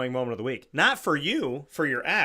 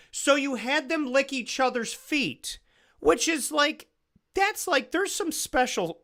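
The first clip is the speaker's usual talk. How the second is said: appalled disbelief; 12 semitones higher, 3 dB louder than usual. Real, not acted. Host reacting to the school's fundraiser, appalled. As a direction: voice leaping very high in disbelief.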